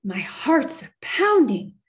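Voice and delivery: high, loud shaken outburst; excited exclamation. Acted. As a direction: blurt it out, still rattled. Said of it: "a man exclaimed".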